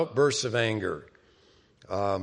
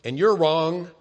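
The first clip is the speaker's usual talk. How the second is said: mock self-righteous insistence, louder and higher; acted argumentative claim, raised voice; insisting on being right; 7 semitones higher, 7 dB louder than usual. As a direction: press the point as if arguing. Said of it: "want to prove".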